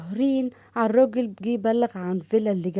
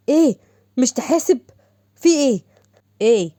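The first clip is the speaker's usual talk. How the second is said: angry outburst; 2 semitones higher, 5 dB louder than usual. Acted. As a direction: snap back after being bumped into. Collision sparks a quarrel.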